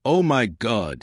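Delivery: ordinary delivery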